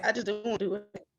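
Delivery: real upset, small quick voice; getting emotional. Real, not acted. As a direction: push the words out softly through emotion. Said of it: "if I'm getting emotional".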